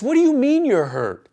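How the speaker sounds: wife suddenly afraid for him; shocked yell; alarmed shout. Retold or imitated. Wife's alarmed reply mid-quarrel.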